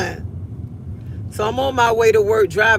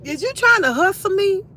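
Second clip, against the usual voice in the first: high, mock-offended question; playfully indignant; 9 semitones higher than usual. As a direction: call his bluff.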